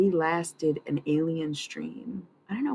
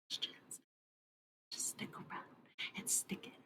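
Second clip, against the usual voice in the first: whisper; 19 dB quieter than usual. Acted. Whispered sign-off aside.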